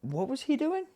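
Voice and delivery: high put-on voice of a school teacher. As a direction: squeak it like a concerned teacher. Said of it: "they go".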